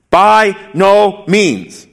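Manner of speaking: By no means drawn out very slowly; emphatic objection. Retold or imitated. Reading Paul's emphatic objection aloud, slow and weighty. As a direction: lean on each word of By no means. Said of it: "his emphatic objection".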